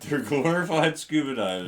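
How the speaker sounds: laughing while repeating. Real, not acted. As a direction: chuckle through the words. Laughing repetition.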